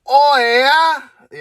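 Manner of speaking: high, drawn-out mock Canadian moan; Canadian impression. Ranter parodies a Canadian in a crude gag, high mock Canadian moan.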